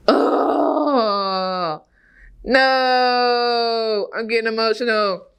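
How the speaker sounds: real emotion catching in the voice; choked up. Real, not acted. Remembering his late cousin, voice choking up. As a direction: fight back the tears and keep talking.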